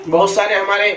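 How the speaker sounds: ordinary delivery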